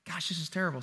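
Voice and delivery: soft, regretful muttering to himself; hushed, lightly acted remorse; mock guilty. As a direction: play a man quietly feeling bad. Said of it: "the man felt guilty".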